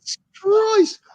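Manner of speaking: shocked exclamation. Real, not acted. Reaction to the penalty.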